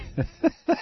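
quiet, high chuckle; light run of soft chuckles; soft laugh. Real, not acted. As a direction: laugh softly to yourself. Laughs after reading the listener email.

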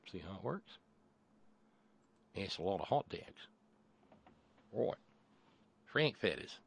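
quiet mock-Australian accent; lightly put-on Aussie aside; Crocodile Kincaid. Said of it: "I probably should have used the Crocodile Kincaid voice".

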